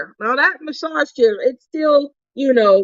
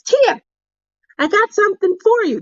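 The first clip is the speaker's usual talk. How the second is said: high, fast call of Tia; high quick imitation of a friend; imitating Debbie calling; 10 semitones higher than usual. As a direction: mimic a friend with a surprise. Debbie calling her, retold.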